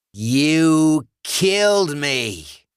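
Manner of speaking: screaming undead John. Acted. Dead John screaming at fleeing Alice, furious bellow. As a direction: bellow it after her. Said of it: "John's screams followed her".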